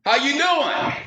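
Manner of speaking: high, drawn-out holler; calling out. Acted. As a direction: holler across the marsh in a high voice. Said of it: "So he yells out".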